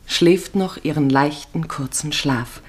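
ordinary delivery

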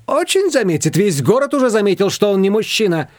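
high-pitched, emphatic scoffing; mocking retort. A narrator's reading. Shushana jeering about an absent man, mocking. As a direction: exclaim it high, mocking the very idea.

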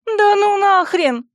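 high, loud, drawn-out yelp; startled yell. A narrator's reading. Princess flinging away a yawning skull staff, yelling. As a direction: cry out in alarm, pitch way up. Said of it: "завопила я".

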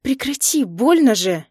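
voiced angry protest, pitch jumping up; hissed protest. A narrator's reading. Wife telling husband his grip hurts, hissed protest. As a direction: protest sharply at being hurt. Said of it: "и я зашипела".

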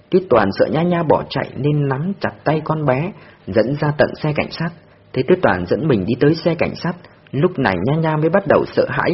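usual speaking voice

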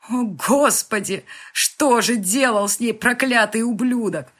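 loud, high cry of desperate agony; agonized wail. A narrator's reading. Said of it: "захлебнулся воплем отчаянной агонии". (отчаянный вопль агонии)

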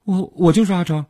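high, quick, stammered reply; reflexive answer. A narrator's reading. Suspect answering the officer by reflex, quick and stammering. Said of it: "下意识地回答".

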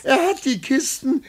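talking high through giggles; can barely contain the joke; delighted, laughing voice; gleeful laughter. Acted. Gleeful news to secretary.

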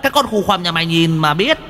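high squeaky sermonizing imp; preachy imp voice. Acted. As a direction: lecture like a smug little demon. Preachy demon voice.

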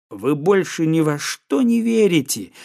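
ordinary delivery

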